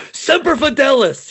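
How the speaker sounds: high, loud patriotic cry; rousing shout. Pretend. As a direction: belt it like a proud Marine. Mock Marine motto exclamation.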